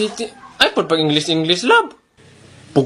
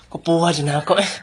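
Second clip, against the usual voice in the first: whisper; 6 semitones lower than usual. Real, not acted. Aside spoken under the breath.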